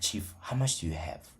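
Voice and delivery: whispering the question on the sly; hushed, secretive ask; whispered question. Retold or imitated. Someone quietly asking the trader his balance, whispered.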